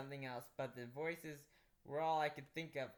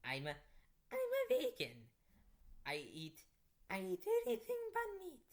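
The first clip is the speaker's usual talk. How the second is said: put-on Transylvanian accent. Vampire girl calms the narrator in a Transylvanian accent. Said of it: "the vampire explained".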